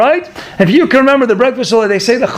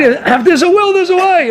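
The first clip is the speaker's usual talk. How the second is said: "there's a way" pitched much higher, leaning on it; raised emphatic repeat; 9 semitones higher than usual. Shown as a demonstration. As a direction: lift the saying up high to drive it home. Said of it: "repeats the saying, raised".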